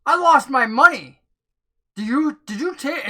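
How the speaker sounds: loud, rising accusation; angry gambler accusing the rogue; aggressive. Acted. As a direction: press him hard, getting in his face. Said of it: "He goes, ... starts getting more aggressive".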